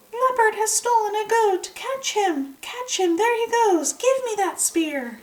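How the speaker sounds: very high, loud shouted alarm; disguised shout. Acted. Dog fakes villagers' cry to scare Leopard, very high disguised shout. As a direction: yell like a whole village is after him. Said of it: "He changed his voice and shouted".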